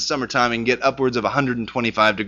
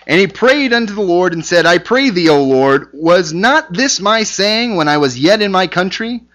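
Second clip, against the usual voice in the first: raised, louder Bible reading voice; scripture reading; 5 semitones higher, 4 dB louder than usual. Acted. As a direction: proclaim the verse to the class. Reads scripture aloud.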